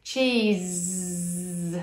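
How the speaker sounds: Cheese, slow, ending on a long buzzing z; voiced z ending. Shown as a demonstration. Therapist shows the voiced z at the end, slow. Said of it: "it's a Z with our voice on".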